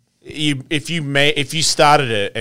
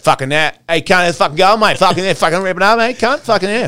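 higher, hammed-up broad bogan Australian accent; broad bogan Aussie; 8 semitones higher, faster than usual. A bogan mate firing up the crew, in a hammed-up broad Australian accent. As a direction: play a rowdy Australian bogan hyping his mates, broad accent. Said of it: "So scumbags talk like that".

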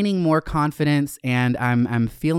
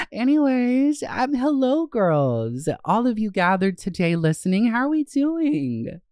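bubbly host greeting; 8 semitones higher than usual. Acted.

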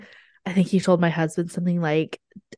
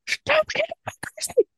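a much higher, mocking replay of her own snap; mock high snap at child. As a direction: replay herself snapping, pitched way up. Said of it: "And I'm like".

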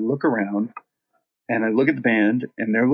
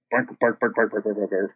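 rhythmic barking. Retold or imitated. Singer retells barking along with the band's snare hits, speeding up. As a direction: say "bark" in accelerating rhythm. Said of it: "in time with the snare hits, which speed up".

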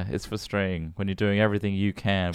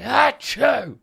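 loud, high theatrical sneeze; obnoxious fake sneeze; 14 semitones higher, 7 dB louder than usual. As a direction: sneeze like it's a statement piece. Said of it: "obnoxious sneezes".

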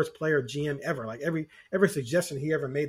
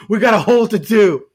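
louder, laughing through the mock complaint; laughing mock whine; 4 semitones higher, 11 dB louder than usual. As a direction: crack up while mocking the fans' whine. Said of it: "and then people cry".

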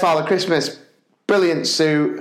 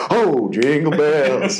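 [name]; much slower than usual. Retold or imitated. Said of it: "going in my [name]".

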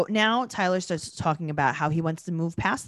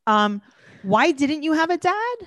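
put-on high, slow teary child's question; mock little girl, pitched up; imitating a little girl; 6 semitones higher, 3 dB louder, slower than usual. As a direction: ask it like a crying four-year-old. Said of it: "she's like".

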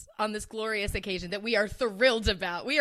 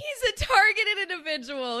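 mocking voice; 10 semitones higher than usual. Echo in a high mocking voice.

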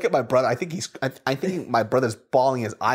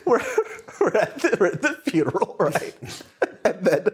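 high, giggly storytelling; laughing while talking; 9 semitones higher than usual. Real, not acted. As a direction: struggle to talk through real laughter. Laughing while telling a funeral story.

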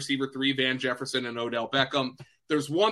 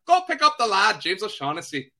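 mock Irish, starting high and loud; lightly hammed-up Irish send-off; badly faked Irish accent, dropping back down at the end; terrible Irish accent; 5 semitones higher, 4 dB louder than usual. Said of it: "That is a terrible Irish accent".

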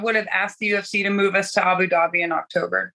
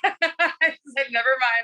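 higher, giggly delivery; amused at yourself; laughing; 5 semitones higher, slower than usual. Real, not acted. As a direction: laugh your way through a quick retreat. Laughs retelling her quick retreat.

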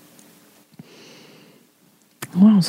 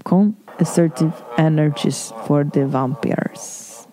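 slow, lower, accented trainer impression; Cesar Millan; 4 semitones lower, slower than usual. Host imitating a calm TV trainer handling vampires, slow impression. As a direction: intone it slowly and low, mock guru. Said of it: "much like Cesar Millan".

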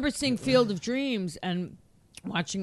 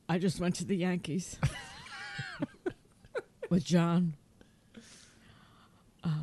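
imitating an overwhelmed man, long broken pauses; halting mock-tearful delivery, struggling for each phrase; choked up. Husband telling wife about the perfect game, mock choked-up. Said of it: "nothing is coming out of his mouth".

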